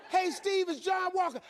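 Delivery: high, loud morning greeting; full-energy phone hello; upbeat caller. Acted. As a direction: imitate a very cheery caller. Acted upbeat phone call.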